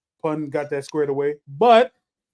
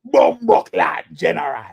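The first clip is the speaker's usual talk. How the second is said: slow booming name call; ring announcer voice; 6 dB louder than usual. Pretend. Repeats gimmick name.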